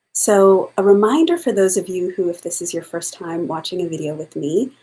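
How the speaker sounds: usual speaking voice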